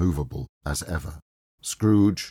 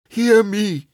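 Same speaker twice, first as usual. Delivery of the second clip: higher, louder anguished begging; desperate pleading cry; 11 semitones higher, 6 dB louder than usual. A narrator's reading. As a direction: plead for his life with the spirit. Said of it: "he cried".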